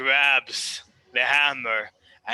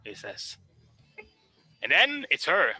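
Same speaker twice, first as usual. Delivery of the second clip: normal talking voice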